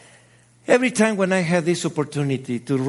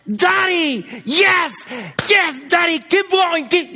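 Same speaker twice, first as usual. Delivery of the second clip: higher, bright daughter calling out to dad mid-race; daughter's cheering voice; 10 semitones higher, 4 dB louder than usual. Retold or imitated. Daughter cheering her running father, high and eager. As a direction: cheer your dad on from the roadside. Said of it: "I heard this beautiful, beautiful sound".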